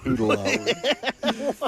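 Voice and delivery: high, drawn-out spell word; slow, high-pitched mock incantation; mock spell-casting. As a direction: stretch it out like a silly incantation. Mimicking the spell-like garbled word.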